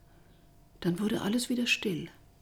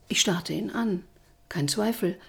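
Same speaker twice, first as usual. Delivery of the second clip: usual speaking voice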